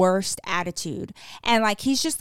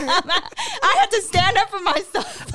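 real laughter through high, drawn-out words; laughing protest; 10 semitones higher, 4 dB louder, slower than usual. Real, not acted. Wife defends fighting back in her sleep, laughing protest. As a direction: crack up while defending yourself, pitch climbing.

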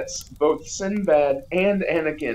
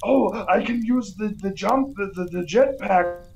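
Arnold Schwarzenegger; 5 semitones higher than usual. Retold or imitated. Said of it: "Arnold Schwarzenegger goes".

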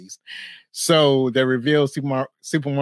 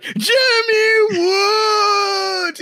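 high, very long mock shout; hugely relieved MC; 6 dB louder, much slower than usual. MC finally spotting the missing contestant, imitated relieved shout. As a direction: holler the name, stretched right out, as a relieved emcee. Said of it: "the M C is so relieved".

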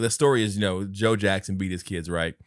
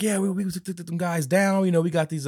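turtle boasting; 8 semitones higher than usual. Retold or imitated. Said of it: "He's like".

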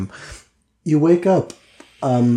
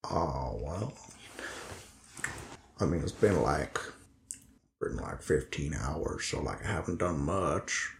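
low, quiet, halting role voice; subdued Linus, weary and hesitant; 8 semitones lower, 13 dB quieter than usual. Acted. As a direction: speak low and softly, with hesitant pauses. Game master voices Linus.

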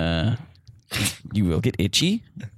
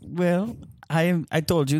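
ordinary talk

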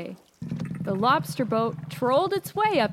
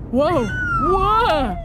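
loud, high yelp of alarm; startled cry; 7 semitones higher, 6 dB louder than usual. Acted. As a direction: cry out as if losing your footing. Lighthouse losing its footing in blast.

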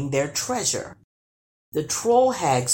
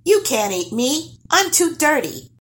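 firm; 11 semitones higher, 4 dB louder than usual. Acted. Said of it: "Nils said firmly".

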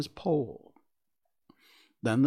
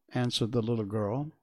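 usual speaking voice

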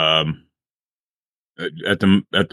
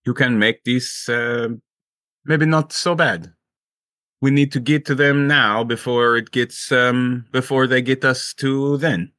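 higher-pitched urgent pleading; pleading character voice; 5 semitones higher than usual. Acted. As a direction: nervously urge the mayor to act.

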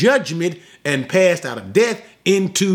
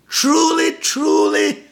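lifted high and ringing; a preacher's soaring Truly, truly; 12 semitones higher, slower than usual. Acted. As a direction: sing it out high, solemn and awed. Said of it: "don't you love them?".

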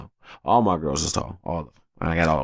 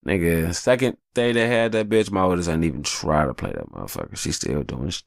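ordinary talk

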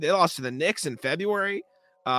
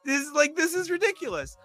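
much higher, disbelieving irritation; irritated, voice climbing; 11 semitones higher than usual. Real, not acted. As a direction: let the voice shoot up in exasperation.

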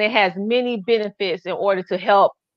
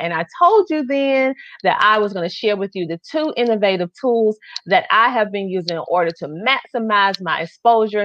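ordinary delivery

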